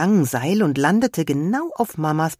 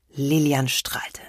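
ordinary delivery, nothing marked

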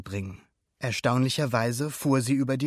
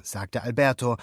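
ordinary delivery

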